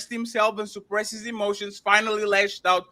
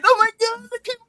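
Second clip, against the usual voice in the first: higher and louder, hammed-up overwhelmed gasp; mock weepy woman; 12 semitones higher, 6 dB louder than usual. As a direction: parody someone melting over a stray dog. Mocking a woman crying over dog.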